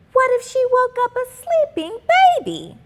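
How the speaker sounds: put-on high, anxious kid's voice; worried girl voice. A narrator's reading. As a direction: suddenly fret about it. Worried aside.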